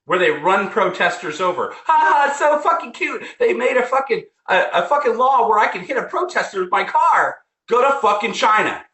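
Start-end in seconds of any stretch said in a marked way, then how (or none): 1.7-7.4 high-pitched, sneering imitation; mock their glee in a raised voice; put-on high voice, making fun of them. Mocking those who cheer the law.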